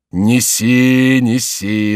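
loud first word, then easing; drawn-out call to a servant. A narrator's reading. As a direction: call eagerly for it to be brought. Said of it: "закричал Горанфло".

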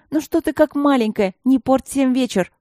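high, loud call; shouting. A narrator's reading. Соседки кричат Алине, чтобы выходила, громко и высоко. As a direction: call her out of the bathroom. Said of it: "закричали девчонки".

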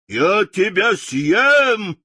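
slow, loud lion roar. A narrator's reading. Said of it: "повторил лев и яростно бросился". (рычащий лев)

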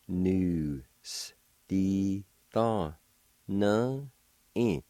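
each of "Nu. S. Di. Tho. Nu. I." held apart; slow, separated syllables with clear pauses. Shown as a demonstration. Teacher pronouncing word number two for learners, one syllable at a time. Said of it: "now I'll pronounce these words".

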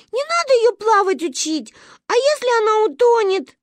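put-on frightened kids begging their father; pleading children. The children beg papa to stop: high loud pleading child voice.

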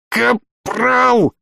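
high, choked call; strangled cry. A narrator's reading. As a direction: call the name through a squeezed throat. Choking man calls for help.

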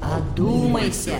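a tempting voice in the mind; whispery telepathic voice. Acted. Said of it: "Шефский голос звучал в голове". (низкий вкрадчивый голос в голове)

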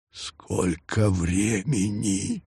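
soft, slow excited whisper. A narrator's reading. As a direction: breathe it out in awe. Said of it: "с волнением прошептал Нестор".

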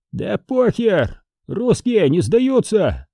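high, slow drawn-out defiant cry; defiant shout. A narrator's reading. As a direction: call out defiantly to the enemy, stretching it. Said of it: "выкрикнул неизвестный собеседник". (протяжный дерзкий выкрик)

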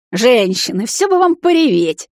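loud, high-pitched cheerful mockery; boisterous teasing. A narrator's reading. Brother teases the crying women, boisterous. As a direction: tease them, voice leaping up high. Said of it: "Ураганом в комнату влетел сияющий Карай".

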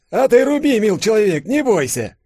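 put-on high, loud defiant old man; fearless old man. A narrator's reading. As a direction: dare him to strike. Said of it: "без страха заявил Савельич". (старик бесстрашно бросает вызов)

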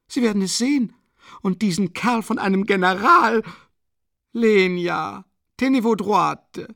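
a desperate, proud widow ranting; agitated Katharina. A narrator's reading. Narrator voices Katharina Iwanowna. (erregte, verzweifelte Mutter, höher gesprochen)

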